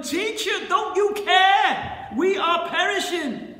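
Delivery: high, loud desperate yell; panicked, accusing shout. Acted. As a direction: yell to wake him up. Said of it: "they yell at him".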